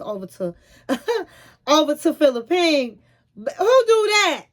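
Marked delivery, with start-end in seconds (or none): 3.4-4.5 incredulous. Real, not acted. Teller mocks the red flag.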